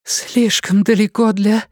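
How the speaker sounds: sore, rasping throat, slow; hoarse rasp. A narrator's reading. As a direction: push words through a raw throat. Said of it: "могла только сипеть".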